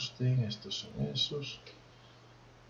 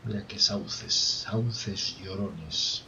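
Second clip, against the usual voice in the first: ordinary delivery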